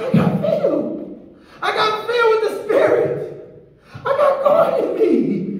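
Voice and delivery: laughing, high and joyful. Retold or imitated. Telling his wife he got filled, laughing. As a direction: laugh out the good news. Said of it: "I said".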